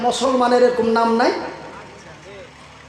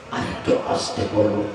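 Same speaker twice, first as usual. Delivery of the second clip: much lower, softer murmured request; asking softly; 6 dB quieter than usual. Shown as a demonstration. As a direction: drop the voice right down. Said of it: "যত আস্তে করে বলতে বলো". (নিচু গলায় আস্তে বলা)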